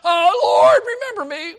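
high, loud desperate cry to the Lord; imagined yell. Retold or imitated. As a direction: cry out as the dying thief, straining. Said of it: "maybe he had to even yell out".

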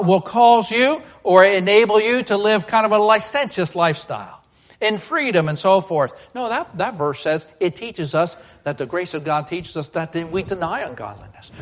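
normal talking voice

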